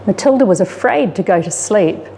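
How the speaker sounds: ordinary delivery, nothing marked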